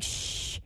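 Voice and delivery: soft, breathy "shh"; imitated hushing. Boy hushing the other kid's music, imitated soft shush. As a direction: shush softly, imitating a kid. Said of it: "He was like".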